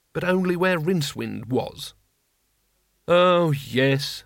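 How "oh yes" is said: sarcastic resignation. A narrator's reading. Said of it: "said Rincewind".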